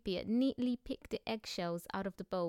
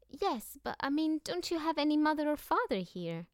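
put-on worried little girl; 7 semitones higher than usual. A narrator's reading. Annika presses the question.

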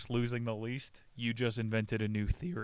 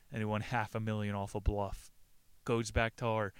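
normal talking voice

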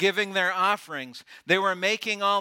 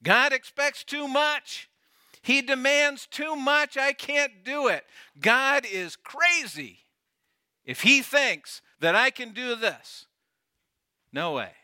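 much higher, whining protest; whiny complaint; 7 semitones higher than usual. Retold or imitated. A rationalizing believer whining that God demands too much.

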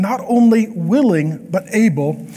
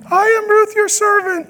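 much higher, hammed-up young woman; girl voice; 13 semitones higher than usual. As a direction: squeak it like a shy young girl. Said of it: "I don't do a good girl voice".

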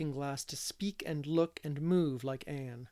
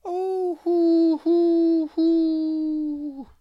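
loud high hooting calls; echo calls; 14 semitones higher, 12 dB louder, much slower than usual. A narrator's reading. Boy Paul calling to the river echoes, loud high hoots. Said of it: "went Paul on the dike, making noises".

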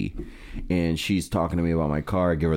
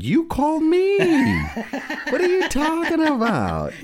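much higher, laughing disbelief; incredulous retort. Retold or imitated. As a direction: squeak it out, baffled. Exasperated retort, retold, laughing.

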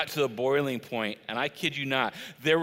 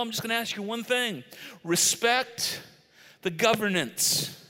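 pitched-up generous pitch, then a firm condition; expansive offer; 8 semitones higher than usual. Retold or imitated. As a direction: sell paradise with rising enthusiasm. Voices God's generous offer in Eden.

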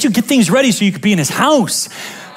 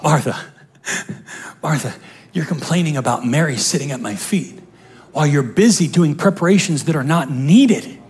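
Jesus speaking; 6 semitones lower, 5 dB quieter than usual. Acted. Jesus gently correcting Martha, low and soft. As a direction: say it low and tender, as the Lord.

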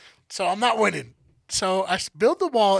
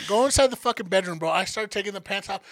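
normal talking voice

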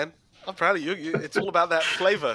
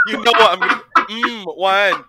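laughing mock taster; 3 semitones higher, 6 dB louder than usual. Mock wine tasting.

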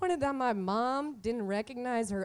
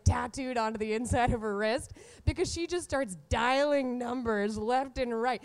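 ordinary talk

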